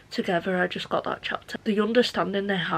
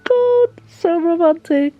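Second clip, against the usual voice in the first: excited gushing; 3 semitones higher, 8 dB louder, slower than usual. Real, not acted. Gushing over a romantic scene.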